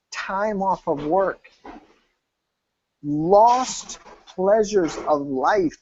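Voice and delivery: ordinary talk, nothing marked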